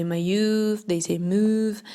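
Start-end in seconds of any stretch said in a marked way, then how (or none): 0.0-0.9 mauve in two syllables, ma-YOOV; wrong pronunciation. Shown as a demonstration. Teacher quoting learners' mistakes with mauve, mispronounced ma-yoov. 1.2-1.8 mauve as MOOV, with a long OO; wrong pronunciation. Shown as a demonstration. Teacher quoting learners' mistakes with mauve, mispronounced moov. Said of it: "those are all wrong pronunciations".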